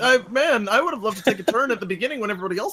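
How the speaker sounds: high, mock-baffled complaint; hammed-up incredulous grievance; sarcastic bewilderment. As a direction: needle them with feigned surprise. Sarcastic complaint about turn order.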